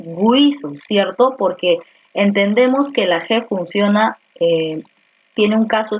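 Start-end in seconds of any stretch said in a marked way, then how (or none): 0.0-0.7 slow GWEE-so for "guiso"; U sounded. Shown as a demonstration. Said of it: "Tú no dices guiso".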